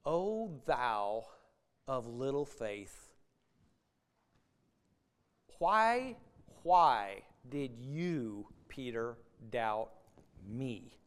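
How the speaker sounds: low, soft, slow reproachful Jesus; condemning reading. Shown as a demonstration. Preacher showing a harsh reading of Jesus to Peter, low slow reproach. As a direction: read it as a cold rebuke, drawn out. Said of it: "the different ways we could read this verse".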